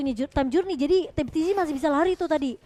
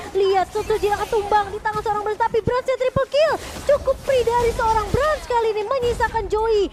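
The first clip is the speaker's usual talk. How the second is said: rising excited casting; hyped play-by-play; 6 semitones higher, 3 dB louder than usual. Real, not acted. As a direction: build the hype through the fight. (komentar langsung yang memanas)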